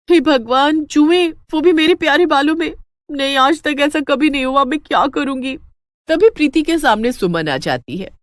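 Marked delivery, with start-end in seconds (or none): 0.1-5.6 lice, horrified. Acted. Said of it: "चक्कर ही आने लगता है". (जुएं देखकर घबराई ऊँची आवाज़)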